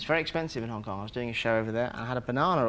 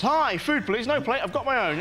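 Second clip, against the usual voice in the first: laughing mock party guest; 9 semitones higher, 4 dB louder than usual. Imagined Oscar guest with lip plate.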